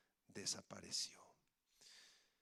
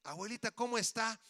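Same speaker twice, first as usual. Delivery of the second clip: grandchild asking; 7 semitones higher, 4 dB louder than usual. Acted. Grandchild greeting grandmother, acted.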